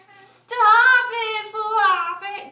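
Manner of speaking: loud, high sing-song grumble; grumbling Cow. A narrator's reading. Cow tells the hopping bunny off, loud and singsong. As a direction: belt it out like a cross cow. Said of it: "grumbled Cow".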